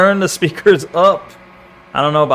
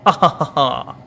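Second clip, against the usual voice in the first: fake laugh; 2 semitones lower than usual.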